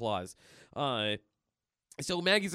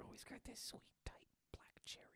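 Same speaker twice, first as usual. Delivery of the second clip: very soft, voiceless whisper; mock-suggestive hush; creepy whisper; 6 semitones higher, 24 dB quieter, faster than usual. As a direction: whisper the lewd joke. Lewd joke whispered.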